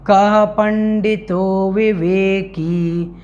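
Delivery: slow, chanted Sanskrit verse; intoned shloka recitation. Shown as a demonstration. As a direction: recite the verse slowly and evenly. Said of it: "सर्वप्रथम उच्चारण से प्रारंभ करते हैं".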